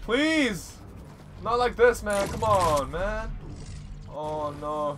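high, loud begging; desperate pleading. Real, not acted. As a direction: plead for your life. About to die in storm.

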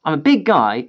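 plain reading voice

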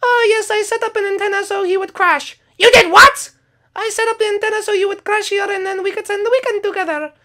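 very high sleepy little character voice; Sing Song voice. Acted. Sing Song agreeing to go to bed, very high squeaky voice. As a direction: squeak it like a tiny sleepy camper.

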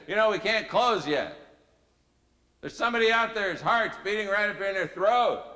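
loud, high preacher's challenge; meeting leader's call. Retold or imitated. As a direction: call it out urgently to the whole room. Acted meeting leader calling out.

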